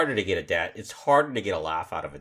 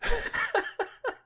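high-pitched giggle; corny dad laugh. Real, not acted. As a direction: break into a squeaky laugh. Laughing at the joke.

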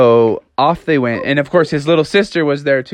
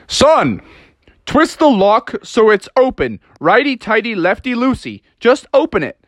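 raised, insistent helper voice; bystander giving instructions; 9 semitones higher than usual. Acted. Bystanders tell Froggy how to unlock it, insistent. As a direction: call instructions through a door, pitched up. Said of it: "they tried to open the door like".

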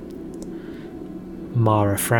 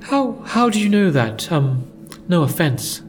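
put-on higher, startled girl; surprised young woman; 8 semitones higher than usual. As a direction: blurt it out, caught off guard. Mara startled in character.

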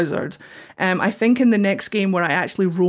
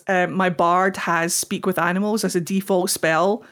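ordinary talk, nothing marked

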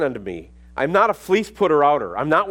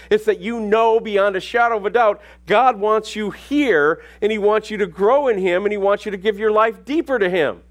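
full-force preaching; 5 semitones higher than usual. Real, not acted.

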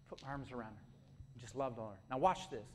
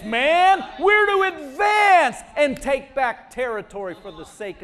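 high, loud, driving sermon shout; rally the congregation at the top of your voice; full-force preaching; 9 semitones higher, 12 dB louder than usual. Real, not acted. Preacher urging the church not to shrink back, shouted exhortation.

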